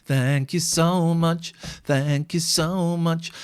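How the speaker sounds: very slow, stretched-out gratitude; drawn-out thanks. Real, not acted. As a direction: draw each thank-you right out. Effusive thanks for a tip.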